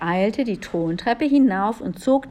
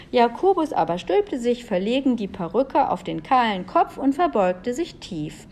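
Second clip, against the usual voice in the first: ordinary delivery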